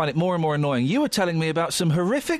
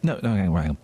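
ordinary talk

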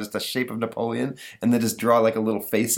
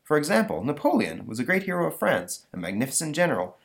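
lightly voiced lecturing teacher, a bit higher; a hint of a patient schoolteacher; 7 semitones higher than usual. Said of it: "teacher there ... saying".

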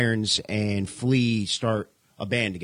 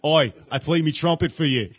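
louder put-on Aussie accent; Australian accent; 4 semitones higher, 5 dB louder than usual. Joking how [name] could talk, Australian accent. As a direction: give it a cheeky Aussie bloke. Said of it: "That would be the best accent if [name] talked like that".

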